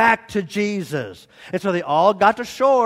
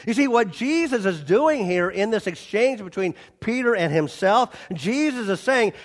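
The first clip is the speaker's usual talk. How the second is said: normal talking voice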